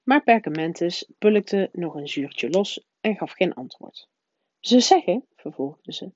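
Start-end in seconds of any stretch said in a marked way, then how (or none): 4.6-5.2 higher, louder prim schoolmistress, insistent; the strict witch pressing her point; stern professor voice. A narrator's reading. McGonagall voiced by the narrator.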